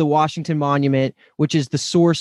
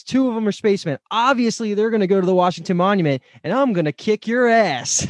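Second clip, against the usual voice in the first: raised-pitch heroic challenge; acted defiance; 6 semitones higher than usual. President challenging Turkey Pete to a fight, acted defiance. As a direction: play the president squaring up for a fight.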